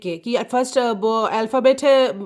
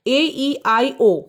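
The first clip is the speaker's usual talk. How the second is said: slow spelled vowels; much slower than usual. Shown as a demonstration. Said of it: "spelling vowels for kids".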